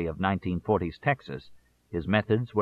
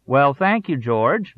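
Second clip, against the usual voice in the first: grinning thanks; 4 semitones higher, 6 dB louder, slower than usual. A narrator's reading. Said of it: "would say with a grin".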